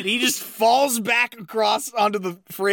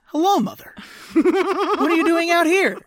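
higher, put-on innocent son voice; nervous innocent greeting; 9 semitones higher than usual. Podcaster voicing the son greeting his mother, mock innocent. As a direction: play dumb and cheerful. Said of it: "And he goes".

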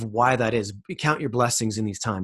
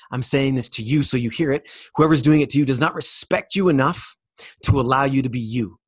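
ordinary delivery

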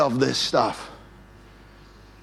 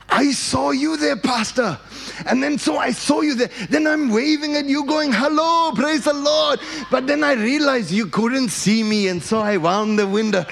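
put-on high, accented storytelling voice; guy from church; 6 semitones higher than usual. Churchgoer telling his pastor about waving, high excited voice. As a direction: imitate an excited churchgoer, pitched up. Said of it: "here's what he said".

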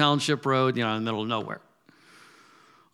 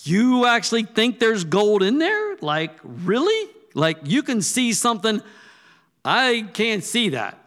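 high, louder disbelieving questions; pitched-up loud incredulity, slowing on the end; incredulous response; 8 semitones higher, 7 dB louder than usual. Retold or imitated. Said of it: "my response".

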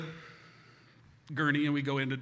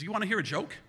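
higher-pitched, teasing doctor voice; doctor's offer; 7 semitones higher, 3 dB louder than usual. Retold or imitated. Bored Doc offers a joke while stitching, teasing.

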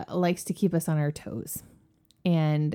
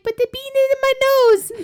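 put-on very high, louder child voice; whiny little kid; 6 dB louder than usual. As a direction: protest like a small child. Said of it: "And she was like".

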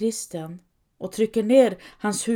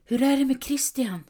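higher-pitched, hushed-bedtime question; anxious question; 6 semitones higher than usual. A narrator's reading. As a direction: ask it high, checking on him. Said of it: "frågar Kerstin".

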